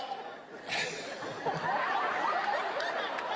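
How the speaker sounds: high breathy disbelieving laugh; real laugh at the absurd claim; incredulous laugh. Real, not acted. Mocking the radio host claim.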